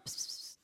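quiet unvoiced psst to lure; whispered cat call psst. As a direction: coax softly like calling a cat. Luring like a cat.

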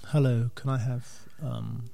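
soft, hushed, timid request; timid sensitive voice. Retold or imitated. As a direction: ask it shyly like a fragile artist. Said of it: "He's all sensitive about it".

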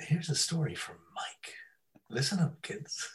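hushed storytelling whisper; playful whispered intro; mock whisper. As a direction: whisper it like a secret bedtime tale.

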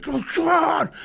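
high, strained shout of urging; psyched-up yell, held back in volume; imitating a screamed battle cry; retold scream. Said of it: "And I'm screaming".

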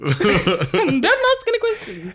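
high, slow mock-lady voice; hammed-up falsetto warning; badly faked woman's voice; man posing as woman. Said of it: "men were dressing up as women".

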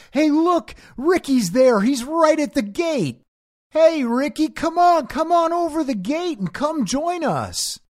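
mock long-distance shout; acted calling out. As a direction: holler as if across a field to a friend. Acts calling to Ricky far behind.

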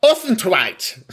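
high, drawn-out mock local accent; stretched, comic Lancashire accent; exaggerated local accent. Shown as a demonstration. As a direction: belt out the place name in a hammed-up local accent. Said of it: "only say it if you go".

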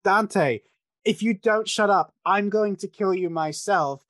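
a hint of an angry mother; mock stern mother. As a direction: scold him like a fed-up mother. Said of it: "she's like".

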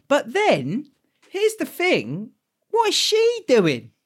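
high, loud, slowed disbelief; incredulous rant. Real, not acted. Ranting at Karen's choice in the film, high incredulous outrage. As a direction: demand an answer in outrage. Ranting about the film's heroine.

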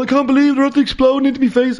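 high, fast mock alarm; panicked cry. Retelling Robbie's shock, high fast mock alarm. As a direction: rattle it off like a frightened kid. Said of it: "he was going".

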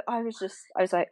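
ordinary delivery

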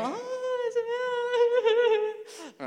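very high squealing "Oh, it's a-" like an amazed American; gushing American reaction. Retold or imitated. Preacher imitating stunned American workers meeting their boss, high squeal. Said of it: "'cause it's American, they go".

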